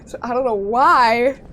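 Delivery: mock protest. Real, not acted. Protesting the chitlins comparison.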